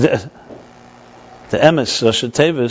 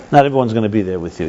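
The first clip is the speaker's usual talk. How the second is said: usual speaking voice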